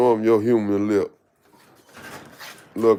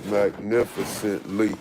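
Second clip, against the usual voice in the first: ordinary talk, nothing marked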